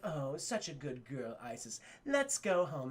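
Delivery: low, soft purring praise for her cat; Selina Kyle voice. Acted. Selina praising her cat Isis, low soft coo. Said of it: "Selena Kyle's like".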